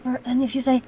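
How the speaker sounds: ordinary talk, nothing marked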